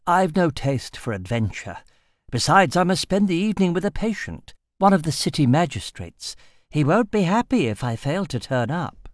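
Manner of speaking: a hint of a prim doctor declining; drab physician.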